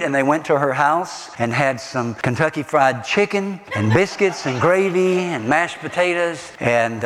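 ordinary delivery

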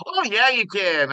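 high, eager mock Minnesotan; Minnesotan accent. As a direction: agree in a high Minnesota singsong. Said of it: "talked like that. It was hilarious".

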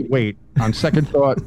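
ordinary talk

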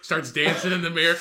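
ordinary talk, nothing marked

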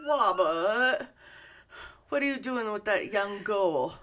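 "Wobbit" with W in place of R; mock speech impediment, R softened in "Wobbit" and "girl"; Dave. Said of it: "he can't say his R's".

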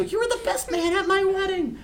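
high squealing goblin voice; very high-pitched, comically aggrieved creature; betrayed goblin. Acted. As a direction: cry out as a wounded goblin friend. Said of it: "one of the goblins go".

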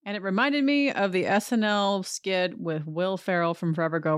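normal talking voice

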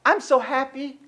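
soft, lightly lifted mock cheer; small put-on happy voice. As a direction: play at being happy, quietly. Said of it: "The funny thing about".

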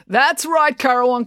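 loud, high announcer call; booming. A narrator's reading. Brad over the speaker system to Currawong, booming. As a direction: project to the whole crowd. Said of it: "his voice booming over the speaker system".

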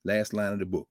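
usual speaking voice

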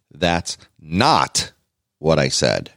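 "not" held alone, pause after it; stress on "not". Shown as a demonstration. Showing co-host how capitals sound, stress on "not". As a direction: hit "not", then drop low on the rest. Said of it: "Not is all capitals because I want it to be".

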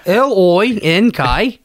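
slow, broad Aussie spelling; hammed-up Australian letters; Australian accent. Shown as a demonstration. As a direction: drag out each letter in mock Australian. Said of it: "do letters in Australian".

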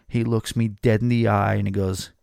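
ordinary delivery

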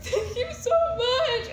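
high, slow, wobbly grateful voice; imitating herself crying with relief; teary thanks. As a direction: squeeze out thanks through mock tears. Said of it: "And I was like".

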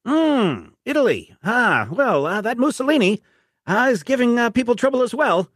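high, hearty officer voice; Brigadier voice. Acted. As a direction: greet the agent like an old commander.

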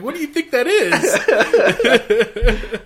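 slow, high and loud through laughter; incredulous laughing. Retold or imitated. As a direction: laugh it out in disbelief. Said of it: "And he's like".

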